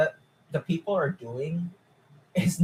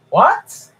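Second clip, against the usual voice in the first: loud, much higher rising what; incredulous what; 13 semitones higher, 9 dB louder than usual. Real, not acted. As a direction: blurt it out in disbelief. Reacting to absurd fan claim.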